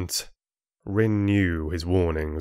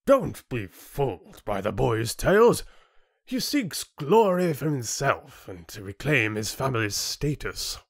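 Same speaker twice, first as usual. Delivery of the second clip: high, lordly accusation; booming chieftain; 5 semitones higher than usual. A narrator's reading. Chieftain discrediting Rin to gasping council, imperious. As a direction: silence the room with scornful authority. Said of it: "the chieftain's booming voice silenced them".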